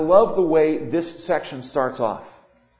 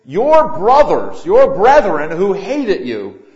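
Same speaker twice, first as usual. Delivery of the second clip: preaching at full force; 3 semitones higher, 11 dB louder than usual. Acted. Preacher exhorting congregation.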